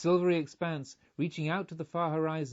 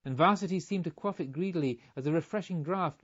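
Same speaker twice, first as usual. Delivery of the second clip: plain reading voice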